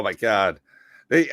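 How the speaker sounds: usual speaking voice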